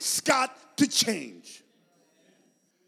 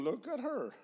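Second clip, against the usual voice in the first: hushed, disapproving aside; gossiping cows; 7 semitones lower, 13 dB quieter than usual. Acted. Other cows watching the mother leave her calf, hushed gossip. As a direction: gossip very softly, sinking lower. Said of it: "the other cows must have been".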